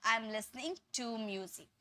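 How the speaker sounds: slow, soft model sentence; slow correct model. Shown as a demonstration. Teacher shows students what to say from now on. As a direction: set it out slowly as the right way. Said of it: "अब से आपको क्या बोलना है".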